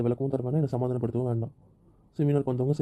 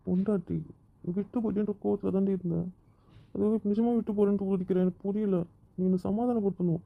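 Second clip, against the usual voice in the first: higher, earnest pleading with his wife; 6 semitones higher than usual. Acted. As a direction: pitch it up and plead. Husband pleads with angry wife. (கணவன் மனைவியிடம் உயர்ந்த குரலில் கெஞ்சுதல்)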